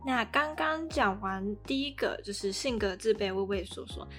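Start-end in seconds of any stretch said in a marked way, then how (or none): none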